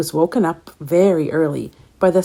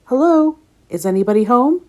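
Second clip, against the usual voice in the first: higher, louder call through the door; 6 semitones higher, 5 dB louder than usual. Acted. Tom calls through Pete's door, high and loud. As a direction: call out to see if anyone's in. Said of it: "called a voice".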